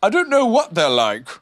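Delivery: high, loud admission; frank. A narrator's reading. Owl admits his ignorance to Rabbit, frank. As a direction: own up outright. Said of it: "said Owl frankly".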